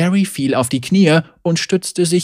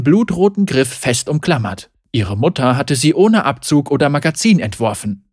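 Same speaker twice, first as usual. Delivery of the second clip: ordinary delivery, nothing marked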